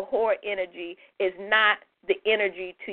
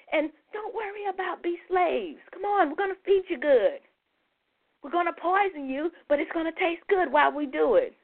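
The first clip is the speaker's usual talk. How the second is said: mocking coaxing voice; 6 semitones higher than usual.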